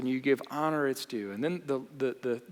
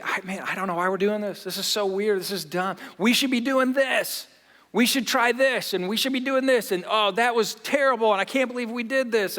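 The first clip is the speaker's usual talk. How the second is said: louder, higher venting at home; exasperated griping, raised voice; frustrated complaining; 6 semitones higher, 6 dB louder than usual. Retold or imitated. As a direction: rant about work to your wife. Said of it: "share my frustration".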